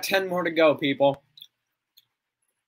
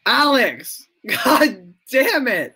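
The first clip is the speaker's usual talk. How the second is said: high, loud outburst, second part drawn out; exasperated yell; 7 semitones higher, 4 dB louder, slower than usual. Real, not acted. Streamer scolding a heckler on a live stream, exasperated yell. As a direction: exclaim higher and louder, in exasperation.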